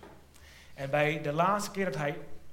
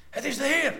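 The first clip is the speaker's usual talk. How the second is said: high, raised burst of recognition; excited recognition; 11 semitones higher, 5 dB louder than usual. Acted. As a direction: blurt it out in joyful surprise. Disciple recognizes Jesus, excited. (opgewonden uitroep)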